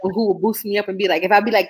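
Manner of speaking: normal talking voice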